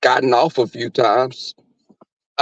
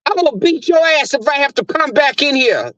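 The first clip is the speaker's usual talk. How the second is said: higher, hollering threat at the dog; heated threat; 10 semitones higher than usual. Retold or imitated. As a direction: cuss the barking dog out. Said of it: "I said".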